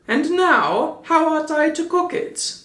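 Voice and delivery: put-on high, eager puppet boy; eager Pinocchio. A narrator's reading. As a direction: muse over the egg with hungry glee. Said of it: "kissing it, said".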